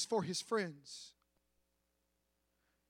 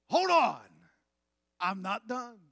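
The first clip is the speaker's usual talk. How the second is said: a hint of a stern father, louder start; stern dad cutting his son off; father's voice; 2 semitones higher, 4 dB louder than usual. Father in the woodshed.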